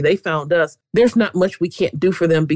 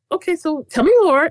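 polite curiosity; 11 semitones higher than usual. Retold or imitated. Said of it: "You're always polite".

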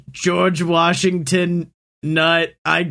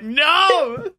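mock no; 8 semitones higher than usual. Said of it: "we're like".

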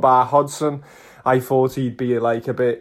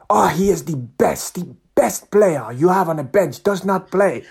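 put-on higher, emphatic Nigerian accent; effusive Nigerian-accented football praise; imitated Nigerian fan; 5 semitones higher than usual. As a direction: rave and protest in a Nigerian accent. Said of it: "fantastic Nigerian guy, full of life".

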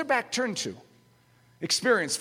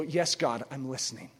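mock devout reply; 8 semitones lower, 7 dB quieter than usual. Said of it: "you go".

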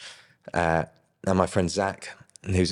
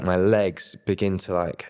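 ordinary talk, nothing marked

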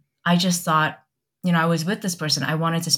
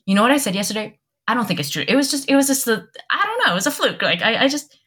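higher-pitched, stumbling backpedal; flustered retraction; 6 semitones higher, faster than usual. Retold or imitated. Nervously taking it back from a friend, flustered. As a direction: take it all back in a fluster.

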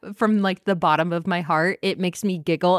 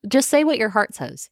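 usual speaking voice

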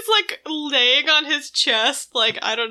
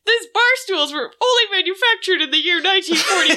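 higher, laughing parody of a random dude; amused, hammed-up announcement; mock proclamation; 7 semitones higher than usual. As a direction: declaim the year with absurd importance. Mocking random dude obsessed with 1941.

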